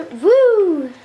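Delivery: long, loud high "woo"; whoop. Real, not acted. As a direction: let out a swooping whoop. Whoops during game action.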